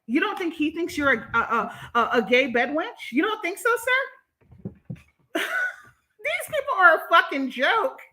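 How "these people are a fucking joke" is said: high, slow, laughing through the words; laughing disbelief. Real, not acted. Streamer laughing at commenters in disbelief.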